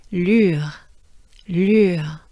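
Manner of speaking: "Lure" loud with held French u and falling end; sustained U. Shown as a demonstration. Teacher tries it together with learners, sustained U. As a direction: draw out the u in "lure", close on French R. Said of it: "let's try it together".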